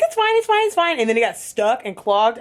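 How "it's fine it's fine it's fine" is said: much higher, nervous it's fine on repeat; mock panic. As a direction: fake calm over rising panic. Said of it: "I was freaking out".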